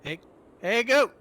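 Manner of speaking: loud call to the goats. Acted. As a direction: shout across the distance. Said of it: "then I'll just call out to them".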